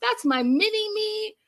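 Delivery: high, slow, cheering woo woo; shrill proud-mom cheer from the back; hammed-up cheering mom; mock screaming mom. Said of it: "Darcy's in the back screaming".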